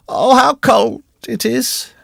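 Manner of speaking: very high, drawn-out wail; shivering cry. A narrator's reading. Shivering recluse warming her feet, high slow cry of cold. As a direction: wail it slowly, as if chilled through. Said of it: "A long shiver traversed her frame".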